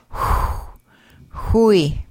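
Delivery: "hui" with a back h and rounded lips; rounded throat h. Shown as a demonstration. Teacher modelling rounded X for learners, rounded h. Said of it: "an H with rounded lips in the back of the throat".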